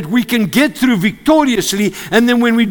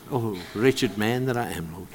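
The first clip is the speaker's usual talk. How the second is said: softer, low sigh to God; quiet lament; 10 semitones lower, 11 dB quieter than usual. Retold or imitated. Preacher retelling his own prayer, quiet lament. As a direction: murmur it as a private prayer. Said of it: "I say to God lots".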